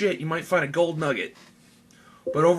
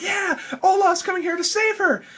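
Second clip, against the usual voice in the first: much higher, louder thrilled hope; excited cheer; 5 dB louder than usual. Retold or imitated. Reviewer reliving Olaf's arrival, thrilled and much higher. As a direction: cheer it like a kid watching the rescue. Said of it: "and I'm like".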